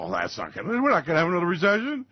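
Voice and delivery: a dismissive know-it-all voice; mocking investors. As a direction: brush off the worry smugly. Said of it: "People go".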